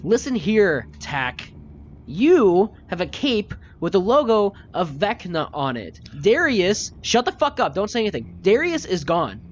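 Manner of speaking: high, loud, intense scolding; furious lecture. Acted. Cassius holding the gnome by the collar and yelling at him.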